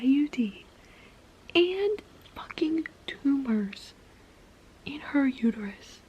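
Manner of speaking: stunned, hushed disbelief; incredulous whisper. Real, not acted. Reader reacting to plot twist: disbelieving whisper. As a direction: whisper it like you can't believe it.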